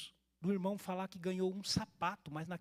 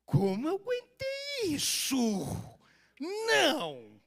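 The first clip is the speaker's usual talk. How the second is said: disbelieving outcry; 10 semitones higher, 9 dB louder, much slower than usual. Retold or imitated. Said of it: "eu falei".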